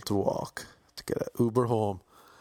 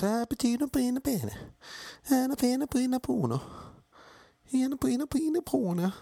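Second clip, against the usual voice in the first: mock Italian gibberish. Imitating Italian girl's chatter.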